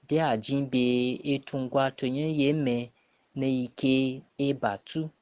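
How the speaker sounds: ordinary talk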